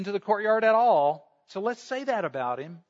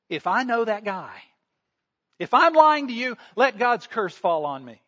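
rising to a loud, high oath on lying; swearing an oath; 3 semitones higher, 5 dB louder than usual. Acted. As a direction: Peter swears a curse on himself, loud. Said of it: "he begins to swear".

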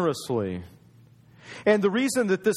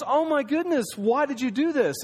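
surprised wife; 8 semitones higher than usual. Acted.

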